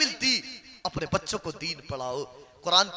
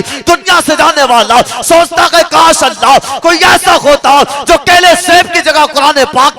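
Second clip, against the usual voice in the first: impassioned preaching; 5 semitones higher, 20 dB louder than usual. Acted. Preacher rebuking mourners over empty funeral customs, impassioned and loud. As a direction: preach at full force, swelling to a high cry. Preaches at rising full force.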